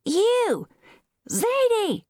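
high, shrill holler for someone; mother's shrill summons; shrill call. A narrator's reading. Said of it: "called shrilly".

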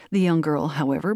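usual speaking voice

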